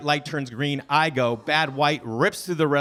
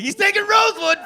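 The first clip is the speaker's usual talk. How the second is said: high delighted yell; high, loud gleeful cry; gleeful shout; 7 dB louder than usual. Retold or imitated. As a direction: crow it like he just blundered. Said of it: "I'm like".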